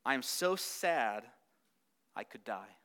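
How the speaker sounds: fading to almost nothing at the end; modern sad paraphrase. Acted. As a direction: say it like someone worn out with sadness. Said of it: "You and I would say".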